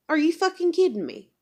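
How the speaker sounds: real exasperated outburst; exasperated outrage. Real, not acted. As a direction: hurl it in outraged disbelief. Ranting at greedy doctors.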